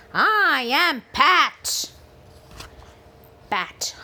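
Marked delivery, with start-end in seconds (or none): 0.1-1.9 slow, loud booming pirate. Acted. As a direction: boom it out like a pirate. Pirate introduces himself.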